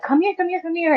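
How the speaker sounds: high-pitched, repeated summons at moderate volume; urgent beckoning. Retold or imitated. Mother summoning child to see the sky, urgent beckoning. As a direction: imitate an excited mother calling a child over.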